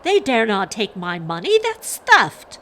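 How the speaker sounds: loud, rising to a high outcry; indignant, climbing to a shout; outraged growl. A narrator's reading. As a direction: protest in angry disbelief. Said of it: "Father growled".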